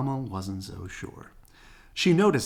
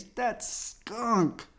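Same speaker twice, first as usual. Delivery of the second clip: high, disgusted cub wrinkling his face; 12 semitones higher than usual. A narrator's reading. Brother complaining to Papa about the smell, disgusted. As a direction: spit out the word like a bad smell. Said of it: "making a face".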